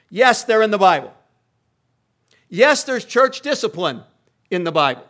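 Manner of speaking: loud, emphatic pulpit declaration; forceful preaching. Real, not acted. Preacher insisting to congregation, loud and emphatic. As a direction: hammer the point home loudly.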